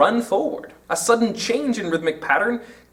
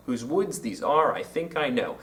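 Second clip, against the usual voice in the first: soft, lowered "Whose woods these are", stressing woods and are; iambic verse reading; 5 semitones lower, 6 dB quieter than usual. Shown as a demonstration. Teacher repeating Frost to count feet, soft stress on "woods" and "are". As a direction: recite quietly with beats on "woods", "are", "think", "know". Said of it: "There are four of them, so it's iambic".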